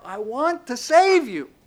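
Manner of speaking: loud, high, drawn-out declaration; crying out. Acted. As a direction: call it out slowly, pleading to rescue. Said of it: "the voice of God crying out".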